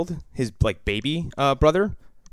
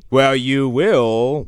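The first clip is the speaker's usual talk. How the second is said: usual speaking voice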